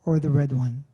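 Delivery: normal talking voice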